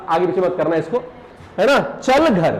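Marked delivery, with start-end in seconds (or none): none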